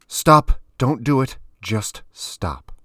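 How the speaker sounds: high, loud cry that drops away; urgent plea. A narrator's reading. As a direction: urgently halt them, easing off by the end. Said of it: "he raised his hands with more urgency".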